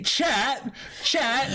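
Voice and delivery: ordinary delivery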